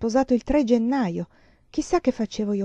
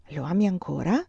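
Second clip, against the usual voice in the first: ordinary delivery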